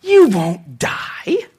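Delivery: very slow, drawn-out insinuation; slippery, lingering reassurance; sly serpent. Acted. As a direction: stretch every word slyly, like a tempting snake. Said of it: "look how sly this guy is".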